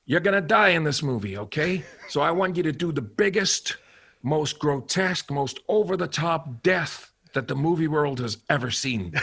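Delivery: John Landis. Retold or imitated. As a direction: pitch the scene big, imitating the director. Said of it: "he was like".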